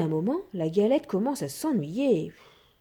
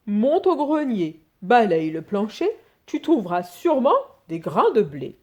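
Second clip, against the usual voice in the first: put-on louder old man giving orders; 4 dB louder than usual. A narrator's reading. Old husband sending his wife to the attic, louder bossy old man. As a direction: send her off, a touch bossy.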